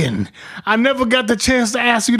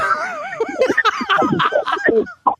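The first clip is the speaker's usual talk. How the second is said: high-pitched helpless laughter; real laughing fit. Real, not acted. Friend recalling the ammonia prank, laughing hard. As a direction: crack up completely. Cracking up at the story.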